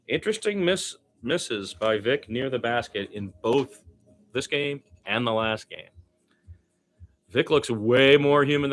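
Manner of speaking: lively live game commentary, pitch jumping with the action; play-by-play call. Acted. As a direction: call the game as it happens for the listeners.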